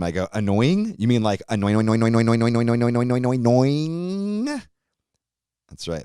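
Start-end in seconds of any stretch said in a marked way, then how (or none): 0.3-4.6 mock-repeating to needle the kid; singsong taunt, rising at the end; teasing repeat. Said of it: "I repeat to him".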